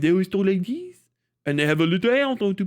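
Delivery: French accent sliding upward at the ends; mock Parisian accent, talking up. Shown as a demonstration. Streamer mocking how Parisians talk, rising French accent. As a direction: mimic a Parisian, lifting the phrase endings. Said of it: "they fucking talk up like that".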